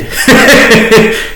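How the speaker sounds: short unvoiced laugh, high; a quick airy laugh at the absurdity; laughing off the idea of being on radio; breathy laugh. Real, not acted. Laughing at own self-doubt.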